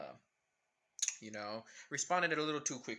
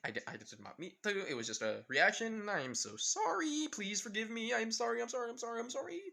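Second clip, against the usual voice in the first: panicked apology; 4 semitones higher, 5 dB louder than usual. Acted. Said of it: "in pain with Karin saying".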